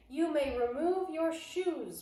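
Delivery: high, loud, slow bark; harsh witch bark; barked order. Acted. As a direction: snap the command at her followers. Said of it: "barked the Grand High Witch".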